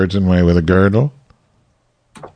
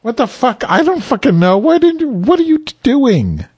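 high, frantic disbelief; shrill what-are-you-doing panic; exasperated outburst; 14 semitones higher than usual. Real, not acted.

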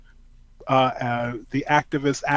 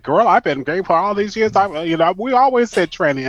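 higher-pitched imitation of a drag queen; 6 semitones higher, 3 dB louder than usual. Quoting the drag host defending her comments, light imitation. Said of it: "She's like".

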